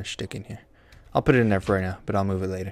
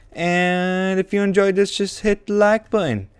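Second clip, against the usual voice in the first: upbeat outro; 9 semitones higher than usual. Real, not acted. Outro sign-off.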